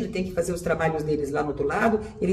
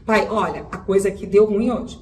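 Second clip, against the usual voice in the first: ordinary delivery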